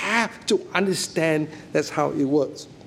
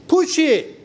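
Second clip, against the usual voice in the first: mock command; 9 semitones higher, 3 dB louder than usual. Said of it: "It's just".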